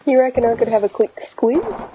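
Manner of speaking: cheery Aussie bloke; matey Australian banter; Australian accent. Acted. Australian captain Davo sketch.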